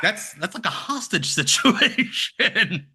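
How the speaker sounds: high, drawn-out incredulous laugh through the words; laughing disbelief. Real, not acted. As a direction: laugh while stretching out the absurd phrase.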